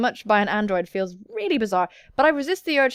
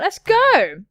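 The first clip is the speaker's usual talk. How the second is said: excited cheer; 8 semitones higher, 8 dB louder than usual. Acted. Persona fan cheer.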